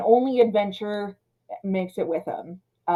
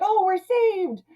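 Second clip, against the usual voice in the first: naive relief; 12 semitones higher, slower than usual. Retold or imitated. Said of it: "Richard Tyler's all like".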